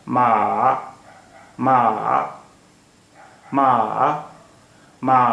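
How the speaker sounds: long मा dipping down, then rising; third tone. Shown as a demonstration. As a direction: draw मा out, dropping then lifting the pitch. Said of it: "नीचे आ रही है और फिर नीचे से शुरू होकर ऊपर जा रही है".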